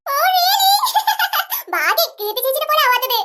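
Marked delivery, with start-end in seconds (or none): 0.1-0.7 high, thrilled squeal of delight. Acted. Little girl told she resembles the baby, delighted squeal. 0.7-1.7 high giggle of delight; giggle, pleased at the compliment. Acted. Little girl pleased by aunt's praise, high giggle.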